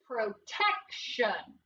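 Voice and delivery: Protection drawn out slowly, syllable by syllable; slow blended word. Shown as a demonstration. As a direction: stretch out Protection slowly for the kids. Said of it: "What's the word?".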